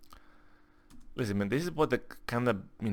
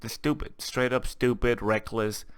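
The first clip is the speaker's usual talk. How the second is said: ordinary delivery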